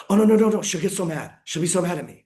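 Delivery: high, urgent reenacted pleading; imitating a panicked plea, bursting out loud then easing; alarmed protest. Retells protesting to cop about arrest.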